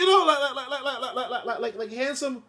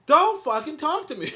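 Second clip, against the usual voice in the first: laughing mock rebuff; 12 semitones higher, 10 dB louder than usual. Woman rebuffing ugly guy, laughing.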